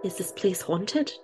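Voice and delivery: put-on slow, soft try at an Irish accent; a hesitant stab at sounding Irish, drawn out slowly; attempted Irish accent. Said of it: "I was trying to be Irish".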